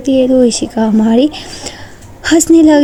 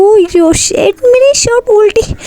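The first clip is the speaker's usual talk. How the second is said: shocked cry; 10 semitones higher than usual. A narrator's reading. Neha discovering her shirt is inside out, shocked. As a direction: gasp it out in dismay. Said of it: "shocked हो जाती है".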